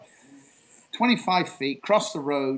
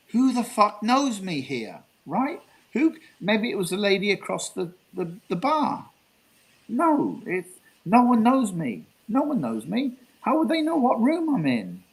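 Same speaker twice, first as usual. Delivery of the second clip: high-pitched, incredulous thinking aloud; acted bewilderment; 7 semitones higher than usual. Lone traveller wonders who knows his room, acted high incredulous bewilderment. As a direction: replay baffled, rising disbelief at who could know. Acts out alarmed thoughts about mystery note.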